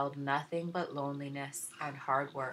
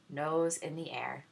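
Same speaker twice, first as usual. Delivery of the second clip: ordinary delivery, nothing marked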